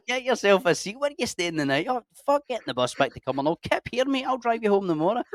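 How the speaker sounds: high, hammed-up friendly insistence; mock over-hospitable host. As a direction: press the guest to stay, playing it for laughs. Imagined stranger pressing hospitality.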